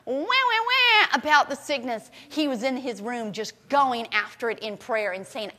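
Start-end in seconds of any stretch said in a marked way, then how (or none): 0.0-1.1 high, slow mock whine; mock whining. Mocking a sick child's whining, high and drawn out. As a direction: whimper like a sulky child. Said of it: "instead of him going".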